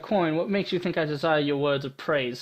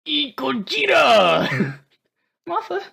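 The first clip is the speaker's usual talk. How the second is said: beast voice; 9 semitones higher, 6 dB louder, much slower than usual. Acted. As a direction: roar it out slow like a beast. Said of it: "was my beast voice".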